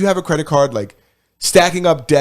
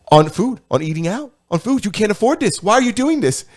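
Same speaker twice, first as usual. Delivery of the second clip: mock scolding host; 7 semitones higher than usual. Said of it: "he's like".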